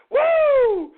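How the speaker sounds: loud, very high "woo"; held falsetto holler; high whoop. Real, not acted. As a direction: let out a long piercing whoop. Whoops after cackling.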